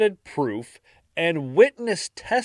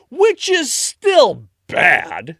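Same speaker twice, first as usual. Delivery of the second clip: drawn out; 9 semitones higher, 7 dB louder, much slower than usual. Real, not acted. Comic aside, dragged out.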